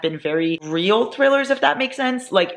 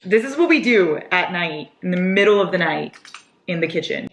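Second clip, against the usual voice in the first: ordinary talk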